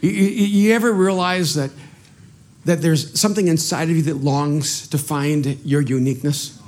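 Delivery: ordinary delivery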